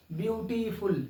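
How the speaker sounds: Beautiful separated as view, टी, फुल; three beats. Shown as a demonstration. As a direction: voice each syllable of beautiful on its own. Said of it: "तीन बार बोले आपने". (Beautiful को तीन बार में अलग करके बोलें)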